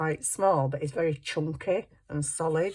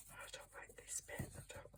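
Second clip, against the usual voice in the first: whispered to herself over the page; a breathy aside, barely voiced; 24 dB quieter than usual. Real, not acted. Crafter whispering to herself about a bit she dislikes.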